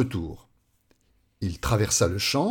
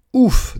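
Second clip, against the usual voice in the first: loud, high puff of relief; relieved "ouf"; 11 semitones higher, 9 dB louder than usual. Acted. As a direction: blow out a big "ouf". Relief once danger has passed. (ouf de soulagement sonore)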